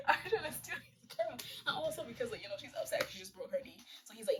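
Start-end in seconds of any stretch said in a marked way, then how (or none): 0.0-1.4 real laughter breaking into the words; higher, giggly retelling; laughing. Real, not acted. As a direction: crack up while telling it. Cracks up at Art's scheming.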